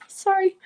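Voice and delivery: apologizing through a sob; a real sob on the word; crying sorry. Real, not acted. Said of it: "sorry, I'm gonna cry".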